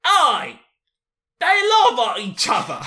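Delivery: hammed-up British accent, exclaiming with delight. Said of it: "Let me do a British accent so I can be [name]".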